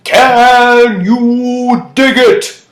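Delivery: very slow, high, full-volume yell; The Warriors rallying cry. Acted. Quoting The Warriors movie, drawn-out rallying shout. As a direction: holler it to a huge crowd, each word stretched. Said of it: "the movie The Warriors".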